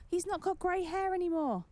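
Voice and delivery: surprised streamer noticing a change. Real, not acted. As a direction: gasp it out in delight. Surprised at the character's look.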